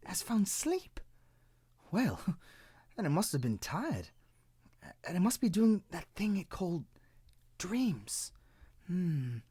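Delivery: high, wondering little cloud. Acted. Cloud muses aloud to itself, high and curious.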